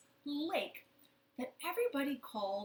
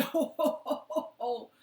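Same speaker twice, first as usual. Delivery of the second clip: round, loud jolly ho ho; ho-ho laugh; 4 semitones higher, 7 dB louder than usual. Shown as a demonstration. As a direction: laugh like a jolly old man. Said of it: "Or sometimes we say".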